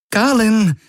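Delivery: high-pitched anxious shout; confused, worried call. A narrator's reading. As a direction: call the name in worry, pitch way up. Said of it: "rief Kirai, gleichermaßen verwirrt wie besorgt". (verwirrt und besorgt gerufen)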